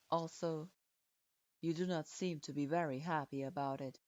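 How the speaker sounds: deep, cool authority pressing his point; stern lord voice. Acted. Eyes her critically.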